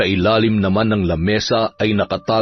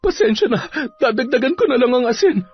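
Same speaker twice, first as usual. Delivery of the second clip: high, quick, meek apology; timid wife in a much higher voice; apologetic wife; 12 semitones higher, faster than usual. Acted. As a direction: placate a cursing husband. Wife apologizes to cursing husband.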